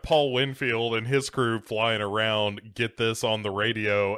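ordinary talk, nothing marked

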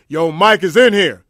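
big slow loud call from the back; loud warning. Retold or imitated. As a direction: shout the warning across the room. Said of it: "the dude in the back goes say".

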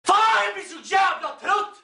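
loud, high, exasperated shout; drunken angry yell. Acted. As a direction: bellow it out, fed up.

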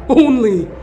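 ordinary talk

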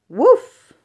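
high, loud, punchy woof; dog bark. Pretend. Reading an animal book to kids, a loud high doggy woof. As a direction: bark it out like a happy puppy.

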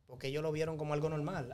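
ordinary talk

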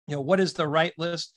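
usual speaking voice

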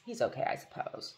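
soft, breathy, grudging half-whisper; a near-whispered grudging admission; jealous mumble. A narrator's reading. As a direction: shrug it off through a pang of jealousy. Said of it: "with a pang of what might have been jealousy".

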